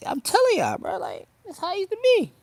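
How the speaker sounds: high put-on voice. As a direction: jump up high and say it playfully. Same words redone in put-on voice.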